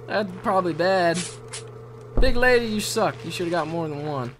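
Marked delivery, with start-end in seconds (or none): none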